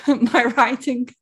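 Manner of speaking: words squeezed out through a laugh. Real, not acted. Laughs mid-sentence about friend.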